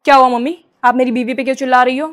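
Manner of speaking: loud, scolding mock husband; angry son. Son angrily scolds his mother to defend his wife.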